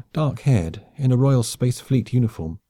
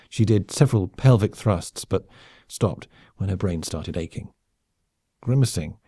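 ordinary delivery

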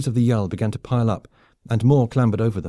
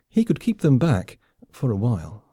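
ordinary delivery

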